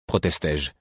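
ordinary delivery, nothing marked

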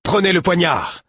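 loud, high-pitched urgent order; shouted command. A narrator's reading. Narrator orders the prince to arm himself, urgent shout. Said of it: "criai-je au prince".

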